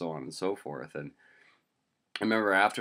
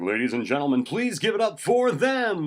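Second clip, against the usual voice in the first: announcer voice; 12 semitones higher, 9 dB louder than usual. Acted. Stand-in announcer introduces people at game, announcer voice. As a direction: belt it out to whip up applause. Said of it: "I cracked that out".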